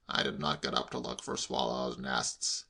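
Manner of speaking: low, slow, measured denial; exasperated uncle. A narrator's reading. Uncle correcting nephew's version, exasperated. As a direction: deny it slowly and low.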